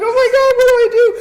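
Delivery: high, loud panicked wail; mock freak-out. Jilted woman begs for a way out, mock freak-out. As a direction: cry out helplessly, hammed-up. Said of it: "I'm freaking out".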